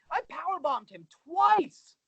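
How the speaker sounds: "twice" stretched out high; slow down and punch "twice"; emphatic boast. Real, not acted. Emphatic boast, then repeated identically.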